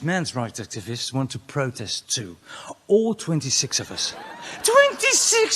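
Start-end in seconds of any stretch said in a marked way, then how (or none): 4.6-5.6 high, loud scoffing police officer; mock police voice. Comedian playing a cop who scoffs at the number. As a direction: squeak the number like a mocking cop. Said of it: "says the police".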